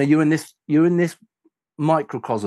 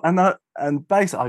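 ordinary talk

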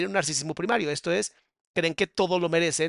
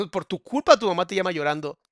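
ordinary delivery

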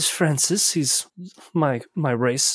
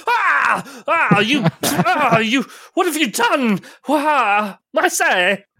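indignant posh jockey; 8 semitones higher, slower than usual. Acted. Jockey poked in the eye.

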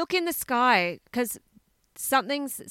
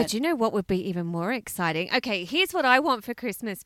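ordinary talk

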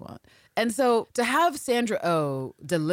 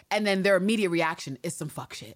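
normal talking voice

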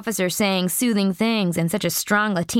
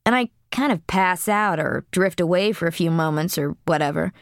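plain reading voice

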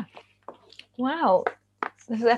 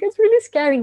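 much higher-pitched mock alarm; retold insistence; 12 semitones higher, 6 dB louder than usual. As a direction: playfully plead your case in a high voice. Retold back-and-forth about a book.

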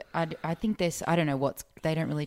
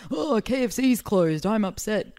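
mock drunk tweet; 6 semitones higher, 5 dB louder than usual. Young [name] drunk-tweeting about closed KFC, whining. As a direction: whine like a tipsy twenty-something.

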